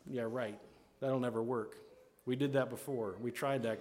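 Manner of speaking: low, quiet dismissive sarcasm; sarcastic. Acted. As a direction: brush the idea off without effort. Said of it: "make sarcastic responses like this".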